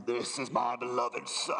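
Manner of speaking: mock Sean Connery voice of God. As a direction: drop low and do a Scottish film-star God. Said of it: "is that like Sean Connery".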